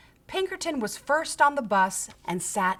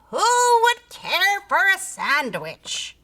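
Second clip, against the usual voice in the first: faint distant creature call; 10 semitones higher, 5 dB louder, slower than usual. A narrator's reading. Creature calls to the scouts from afar. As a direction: sing it out like a far-off lure.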